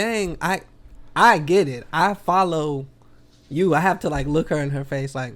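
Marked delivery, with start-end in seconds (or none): 0.0-3.7 mock agreement. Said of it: "And I'm like".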